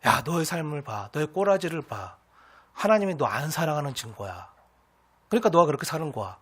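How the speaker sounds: low, soft needling in a tempter's role; quiet mockery. Mocker at the believer's side, quietly taunting. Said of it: "우리를 조롱하고 옆에서 흔들고".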